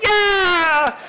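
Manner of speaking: high, loud, drawn-out whoop; all-out shouted cheer; exuberant shout. Retold or imitated. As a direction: let out a huge triumphant yell. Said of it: "raised in intensity and volume and passion".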